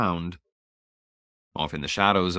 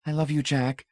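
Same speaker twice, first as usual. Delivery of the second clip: wife's wretched goodbye; quavering; 6 semitones higher than usual. A narrator's reading. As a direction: let the voice tremble. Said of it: "Marcia quavered wretchedly".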